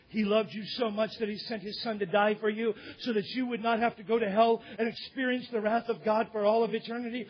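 normal talking voice

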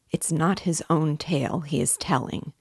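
ordinary delivery, nothing marked

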